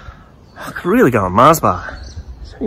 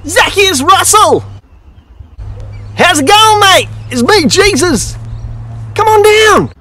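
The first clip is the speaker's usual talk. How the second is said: much higher, slow, hearty Aussie mate greeting; Jesus, cheerful; slower than usual. Retold or imitated. Jesus inviting Russell down from the tree, high cheerful. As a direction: beckon him down like an old friend. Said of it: "and goes".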